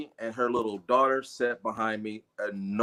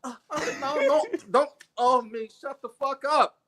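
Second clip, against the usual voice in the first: high, laughing, flustered mom voice; flustered mother; 10 semitones higher than usual. Retold or imitated. Man imitates the mother stammering at him, high and flustered. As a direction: fumble like a shocked mom. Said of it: "And the mother was like".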